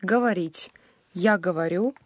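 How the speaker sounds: usual speaking voice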